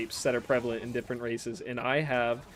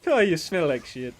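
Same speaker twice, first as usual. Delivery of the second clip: put-on a slightly higher, louder Irish accent; mock Irish accent; 4 semitones higher, 5 dB louder than usual. Streamer keeping up the fake Irish accent. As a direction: toss off a jokey Irish insult. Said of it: "I was gonna do an Irish accent".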